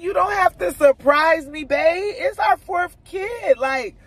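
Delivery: high, loud imitation of husband protesting; exasperated reassurance. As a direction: act out his insistent, drawn-out protest.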